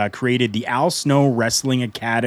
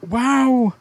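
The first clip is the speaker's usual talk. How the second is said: amazed; 13 semitones higher than usual. Real, not acted. Marveling at an old match.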